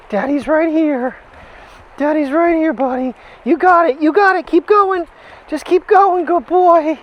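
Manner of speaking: high, loud doggy cheerleading. Real, not acted. Coaxing dog over obstacle.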